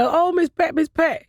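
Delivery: high, fawning fan calls; lightly voiced gushing fans; mock fan greeting.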